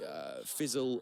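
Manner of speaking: usual speaking voice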